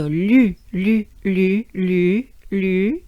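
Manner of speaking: repeated "lu" with French U vowel. Shown as a demonstration. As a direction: say "lu" in even, separate syllables. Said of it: "this sound U goes into lu".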